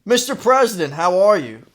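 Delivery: needling the president with fake politeness; mock fed-up Republican. Podcaster greeting the president as a fed-up Republican, mock polite. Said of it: "I took on the personality of a fed-up Republican".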